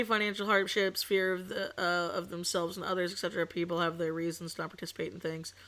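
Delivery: usual speaking voice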